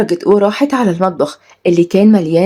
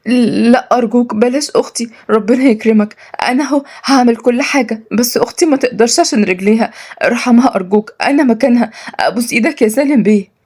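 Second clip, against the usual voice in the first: higher, urgent begging; pleading; 4 semitones higher than usual. Acted. Girl begs master to spare her sister, pleading. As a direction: plead anxiously for mercy.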